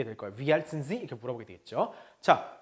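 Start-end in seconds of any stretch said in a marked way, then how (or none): 0.3-1.0 "Wie alt sind Sie" run together; fluent, without gaps between the words; quick, linked speech. Shown as a demonstration. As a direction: let "sind Sie" merge into "zinzi". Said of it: "빨리 발음하다 보니까".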